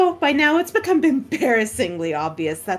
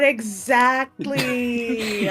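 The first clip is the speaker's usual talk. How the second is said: very slow echo; much slower than usual. Pretend. Echoing the joke word.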